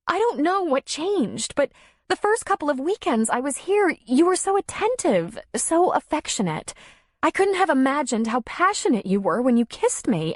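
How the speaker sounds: high, pleading, close to crying; tearful pleading. A narrator's reading. Darla pleading with Tom, tearful and high. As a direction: beg him to explain. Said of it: "Her eyes filled with tears".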